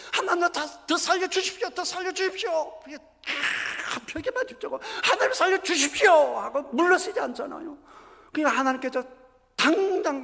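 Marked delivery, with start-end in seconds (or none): 0.1-2.8 high, pleading begging for life; desperate prayer. Retold or imitated. Hezekiah facing the wall begging God, high desperate plea. 5.0-6.1 high, pleading begging for life; desperate prayer. Retold or imitated. Hezekiah facing the wall begging God, high desperate plea.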